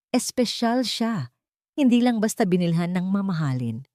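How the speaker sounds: ordinary delivery, nothing marked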